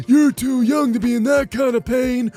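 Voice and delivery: mocking impression of a grown-up scolding a kid; put-on condescending adult voice; dismissive adult. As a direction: brush off the child like a know-it-all elder. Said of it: "an adult told me".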